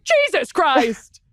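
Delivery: slow, drawn-out startled gasp of words; shocked exclamation. Retold or imitated. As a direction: blurt it out in shock. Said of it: "I was like".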